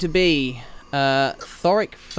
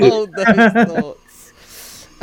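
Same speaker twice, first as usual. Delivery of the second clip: drawn-out mock pleading; 6 semitones higher, 6 dB louder, much slower than usual. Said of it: "GM stalls players".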